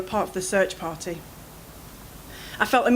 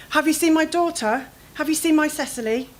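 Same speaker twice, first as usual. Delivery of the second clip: anxious plea; 7 semitones higher than usual. Acted. Mother searching for lost daughter.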